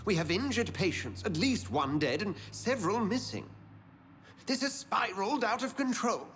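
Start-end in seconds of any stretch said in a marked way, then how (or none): 2.2-6.3 alarmed report. Acted. Alarmed report of hospital attack.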